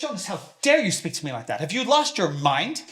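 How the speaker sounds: father rebuking his son for insolence, furious; furious rebuke. Acted. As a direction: tear into him for his insolence.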